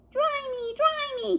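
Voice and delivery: very high pleading chick; soggy baby chick. Acted. Soggy chick to Jack, very high pleading. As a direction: whine to be dried. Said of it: "cried the soggy baby chick".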